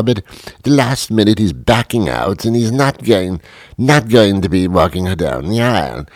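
urgent British official pleading; British accent. Acted. Press secretary begs him to walk Meghan, British accent. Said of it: "had a British accent".

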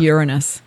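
"Uranus" said as YOOR-uh-nus; classy pronunciation. Shown as a demonstration. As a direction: stress the first syllable of "Uranus". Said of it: "trying to be a little classy about it".

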